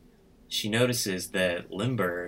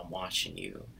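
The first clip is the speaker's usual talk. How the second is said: low, soft, menacing "I'm watching you"; a quiet sinister warning; mock menacing warning; 8 semitones lower, 7 dB quieter than usual. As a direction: hush it like a creepy threat. Creepy character warns her.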